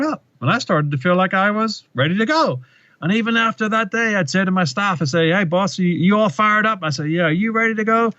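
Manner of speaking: high-pitched, rallying retold speech; Obama's changed storytelling voice. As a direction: imitate a politician working up a crowd, pitch climbing high. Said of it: "his voice changes and he goes".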